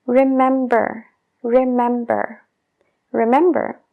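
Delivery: "Remember" with a full mb, slow first take; b sounded in remember. Shown as a demonstration. Teacher repeats "remember" carefully for the class. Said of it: "la palabra remember".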